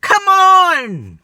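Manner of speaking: real exasperated yell; frustrated shout. Real, not acted. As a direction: yell at them to come over. Pleading shout at targets.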